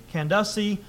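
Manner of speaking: Candace said as can-DUH-see; alternative pronunciation. Shown as a demonstration. Preacher shows another variant, Candace as can-DUH-see. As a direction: say Candace in three syllables with a short middle, can-DUSS-ee. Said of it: "I've also heard".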